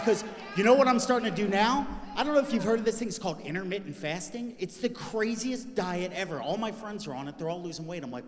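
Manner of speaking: normal talking voice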